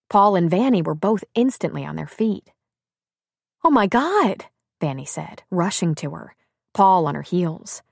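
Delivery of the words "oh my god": higher-pitched alarmed exclamation. A narrator's reading. Friend rushing to crying Abby, alarmed. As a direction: gasp it out in concern.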